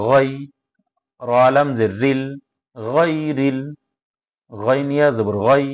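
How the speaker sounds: measured, intoned letter-by-letter recitation; teacher's Arabic recitation for learners to follow; Quran spelling drill. Shown as a demonstration. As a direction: spell out each syllable, then join it in a lilting chant.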